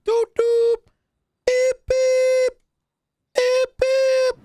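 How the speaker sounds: high, loud electronic beeping; bomb timer beeps. Pretend. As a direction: mimic a ticking bomb timer beep. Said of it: "What the hell did we run into?".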